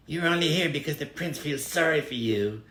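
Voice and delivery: mean bride, high and sneering; spiteful bride. Acted. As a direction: belittle her coldly. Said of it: "she told the little mermaid".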